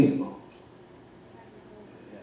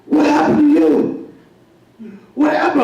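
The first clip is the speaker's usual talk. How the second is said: the wife's alarmed call, higher; huge wife finding her shaved husband, raised alarm; angry wife; 7 semitones higher than usual. Retold or imitated. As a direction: demand an answer, pitched up. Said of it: "she said".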